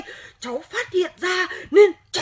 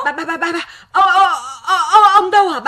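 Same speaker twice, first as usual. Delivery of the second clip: panicked stammer; 5 dB louder than usual. Acted. Panicked girl asks for grandfather.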